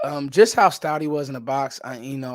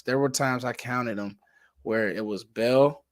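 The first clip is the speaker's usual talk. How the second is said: normal talking voice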